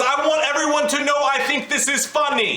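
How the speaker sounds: high, emphatic mock announcement; mock show-off declaration. Friend voices what the mom's fake laugh says, high mock proclamation. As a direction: imitate someone proclaiming it for all to hear. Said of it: "It was a laugh that says".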